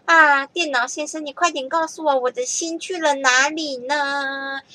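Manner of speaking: put-on high, slow sing-song child's plea; childlike plea. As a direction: ask it like a small child begging. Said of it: "这首歌就是说呢".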